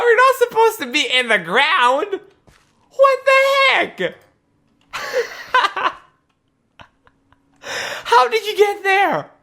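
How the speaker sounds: high-pitched squeaky surprise. Acted. Finding Bob stuck in ground.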